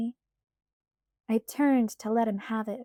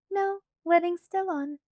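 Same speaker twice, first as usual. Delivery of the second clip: put-on light, higher girlish pleading voice; Alice's voice; 8 semitones higher, 4 dB louder than usual. A narrator's reading. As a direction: voice Alice higher, coaxing Bella.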